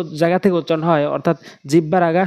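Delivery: ordinary talk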